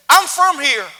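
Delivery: high, loud cowboy call; excited Texan shout. Retold or imitated. Texan rancher calling out loud to the speaker. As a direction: pop up and holler at the speaker. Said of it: "awesome Texan rich accent that I wish I could capture".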